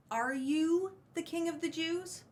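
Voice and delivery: "you" lifted high and leaned on; stress on YOU. Shown as a demonstration. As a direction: make "you" the point of the question. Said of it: "the "you" is very emphatic".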